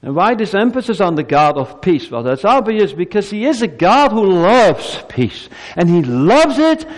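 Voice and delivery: high, loud fervent preaching; full-force preaching. Acted. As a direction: lift the congregation with it.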